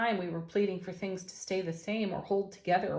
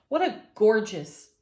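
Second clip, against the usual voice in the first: delighted; 8 dB louder than usual. Real, not acted. Delighted by the card spread.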